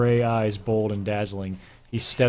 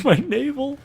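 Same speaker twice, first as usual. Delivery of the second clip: squeaky giggly read. Real, not acted. Reader squeaks reading sex scene.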